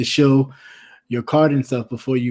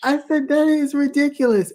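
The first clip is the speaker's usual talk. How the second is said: scandalized; 12 semitones higher than usual. Retold or imitated. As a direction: scoff at the price. Outrage at price.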